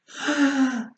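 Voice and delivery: a high, loud gasp of alarm; shocked gasp. Acted. Mother gasps loudly at her son's rainbow-coloured tongue.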